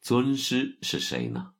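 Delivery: lightly acted curious inquiry. A narrator's reading. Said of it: "就好奇地问".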